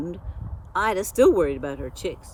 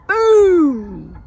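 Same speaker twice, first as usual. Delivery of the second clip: loud boom; 9 semitones higher, 13 dB louder than usual. A narrator's reading. Firecrackers explode in story.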